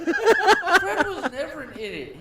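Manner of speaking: indignant loud protest. Real, not acted. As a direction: protest that Fred was never an idiot, loud and slow. Defending Fred indignantly.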